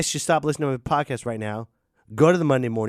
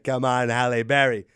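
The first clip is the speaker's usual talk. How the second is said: ordinary talk, nothing marked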